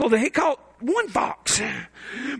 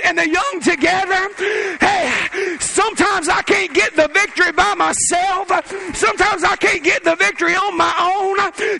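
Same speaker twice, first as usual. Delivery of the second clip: shouted, rising revival call; full-force preaching; 5 semitones higher than usual. Acted. Pastor urging the young to lead revival, shouting. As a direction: lift the whole room with each line.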